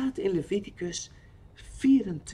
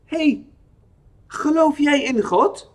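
trembling voice; 8 semitones higher, 6 dB louder than usual. A narrator's reading. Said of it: "Zijn stem trilt een beetje".

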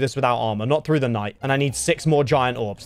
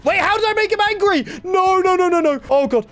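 high, loud frantic pleading; real terror as the mob charges; panicked shriek; 3 dB louder than usual. Real, not acted. Mob turns angry, he panics.

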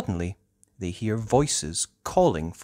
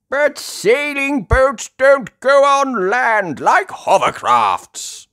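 slow, loud, high protest; aghast; 10 dB louder, slower than usual. A narrator's reading. Grandpa Pig shocked at boats on land, aghast. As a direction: exclaim it in disbelief. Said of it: "gasps Grandpa Pig".

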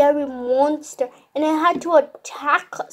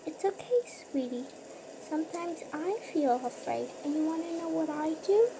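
hushed comforting mother; 13 dB quieter, faster than usual. Acted.

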